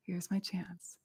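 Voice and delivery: slow, hushed sigh-whisper. Retold or imitated. Teacher whispering back to her inner voice.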